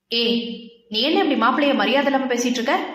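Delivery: plain reading voice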